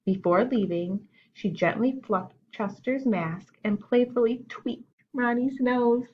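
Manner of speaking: plain reading voice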